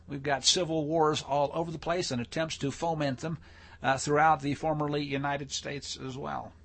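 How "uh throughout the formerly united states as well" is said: normal talking voice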